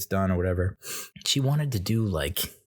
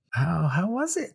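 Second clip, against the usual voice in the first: high mock receptionist voice; imitating the receptionist; 10 semitones higher than usual. The front desk woman asking, a high mock voice. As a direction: lift the voice to play her. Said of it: "the woman was like".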